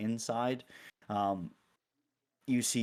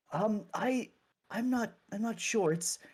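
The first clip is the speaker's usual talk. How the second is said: higher, halting clergy voice; unsure, stumbling helper pitched up high; hesitant clergy member; 8 semitones higher than usual. Acted. Game master plays a clergy member.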